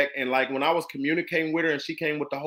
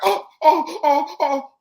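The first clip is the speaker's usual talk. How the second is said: high-pitched, louder mock "oh"s; mock dismay; 12 semitones higher, 5 dB louder, slower than usual. Playing the rude broker just told she's fired, hammed-up dismay. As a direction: pile up exaggerated high cries of alarm. Mimics reaction to being fired.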